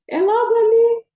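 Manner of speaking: excited exclamation. Real, not acted. Telling followers her Paris dream is near, excited exclaim. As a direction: shout "logo ali" with high, bursting excitement. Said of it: "Eu quero ir pra Paris".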